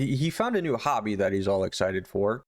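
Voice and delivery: ordinary delivery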